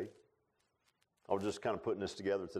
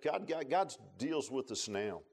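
usual speaking voice

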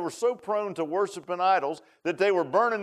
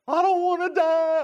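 put-on high, sobbing little-boy wail; imitating a crying child; 12 semitones higher, 4 dB louder than usual. Little boy crying to his mom at bedtime, high sobbing wail. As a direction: cry it like a scared five-year-old. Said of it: "I was crying".

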